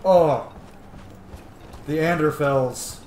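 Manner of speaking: light up at a favourite place; delighted recognition. Real, not acted. Streamer recognizing the Anderfels, loud delight.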